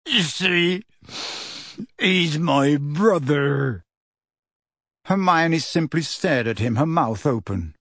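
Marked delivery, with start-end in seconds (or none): 0.0-3.8 halting, drawn-out confession; anguished gentle giant; tearful. A narrator's reading. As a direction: confess it brokenly, with long pauses. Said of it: "tears now trickling down his bruised face".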